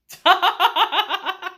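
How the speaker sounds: high, loud laughing fit; can't hold the laugh in; loud laughter. Real, not acted. Vlogger still laughing at the kiss, loud high laugh.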